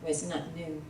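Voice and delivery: usual speaking voice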